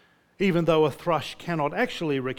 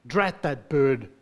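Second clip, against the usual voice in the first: mildly cross grumble in read-aloud; 4 dB louder, slower than usual. A narrator's reading. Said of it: "said Bilbo crossly".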